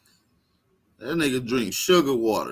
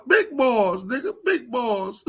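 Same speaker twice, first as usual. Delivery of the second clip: high mocking crybaby voice; hammed-up, pitched-up caricature; whiny rival impression; 9 semitones higher, slower than usual. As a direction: parody his catchphrase in a near-tearful whine. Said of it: "Sound like he about to cry".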